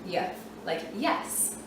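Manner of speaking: slow, soft "Y, like yes" demo; sounding out Y. Shown as a demonstration. Teacher demonstrating the Y sound in yes. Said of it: "Y says its consonant sound".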